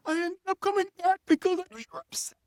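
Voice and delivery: very high, fast girlfriend imitation; mock upset girlfriend. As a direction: whine it out in a high put-on voice. Mimics upset girlfriend explaining.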